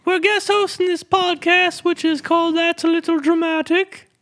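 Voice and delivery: put-on very high falsetto English accent; silly English host in a squeaky falsetto. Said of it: "Do you have a bit of an English accent".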